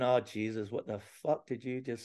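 put-on low-pitched Scottish accent; Scottish inner voice. Inner voice lying in bed, berating himself in Scottish accent. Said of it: "my inner thoughts are Scottish with a stutter".

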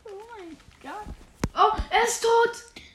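excited cheer. Real, not acted. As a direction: shout the victory. Golem finally killed.

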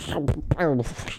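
spluttered whoosh of someone sent flying; imitated flying sound. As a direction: mouth a comic whoosh. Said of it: "and he went".

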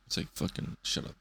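normal talking voice

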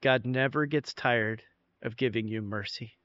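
dropped to a soft, near-hushed, sincere promise; hushed reassurance. Shown as a demonstration. Pastor assuring weary congregation, hushed and sincere. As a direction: lean in close and say it gently like a secret. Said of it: "let me tell you this. You ready?".

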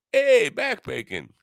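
hammed-up New York shout; New York hey. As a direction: bellow it like a New Yorker down the block. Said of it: "In New York, we say".

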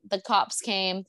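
ordinary talk

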